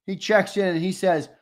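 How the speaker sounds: usual speaking voice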